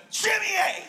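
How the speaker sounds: high, sudden yelp of a made-up curse; mock cuss shout. Stubbing a toe, shouting the new cuss word. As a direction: blurt it like you stubbed your toe. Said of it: "be like".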